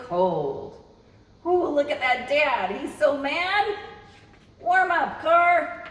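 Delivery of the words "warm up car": louder, higher cross dad urging; grumpy dad voice. Acted. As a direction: hurry the car like a fed-up dad.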